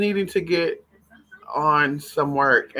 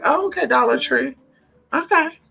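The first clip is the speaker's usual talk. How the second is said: sarcastic; 8 semitones higher than usual. Retold or imitated. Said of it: "So I'm like".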